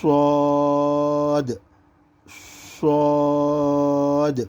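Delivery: صاد with a rounded heavy s, vowel held long; soft whistling صاد. Shown as a demonstration. Teacher demonstrating the letter, صاد rounded and drawn out. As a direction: whistle softly into a stretched صاد. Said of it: "نرم آواز نکالیں گے اس کو".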